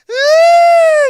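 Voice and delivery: high, loud mock cry burst; loud wailing sob. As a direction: imitate her sudden bawling. Woman bursts out crying.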